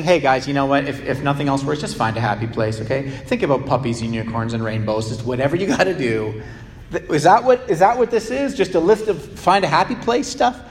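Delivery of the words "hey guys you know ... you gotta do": mock flippant advice. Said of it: "suddenly saying".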